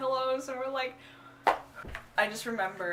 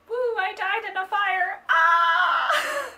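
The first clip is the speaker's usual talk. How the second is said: much higher, louder giddy in-character cry; hammed-up shriek; 11 semitones higher, 11 dB louder, much slower than usual. Cosplayer hamming up her dead character, high giddy yell. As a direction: go big and shrill, then yell.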